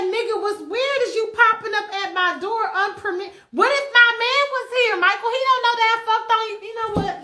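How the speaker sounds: high-pitched, rattled telling-off; hammed-up outraged rant; indignant scolding. [name] scolds [name] for popping up at her door, indignant. Acted scolding of unexpected visitor.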